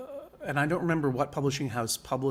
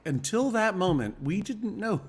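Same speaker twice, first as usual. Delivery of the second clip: higher-pitched editor retelling; lightly voiced editor, pitched up; quoted book editor; 7 semitones higher than usual. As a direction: relay it in a higher voice. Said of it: "was like".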